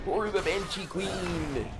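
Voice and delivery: high, slow rallying call for the Banshee Queen; slow battle cry. Pretend. Player rallies for the Banshee Queen, slow hammed-up war cry. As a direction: draw out the cry like an undead soldier.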